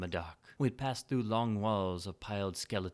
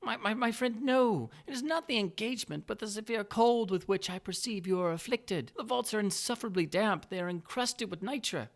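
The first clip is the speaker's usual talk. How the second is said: higher, louder, smoothly flattering nobleman; butter him up with mock delight; Montresor's sly greeting; 12 semitones higher, 4 dB louder than usual. Montresor warns of damp.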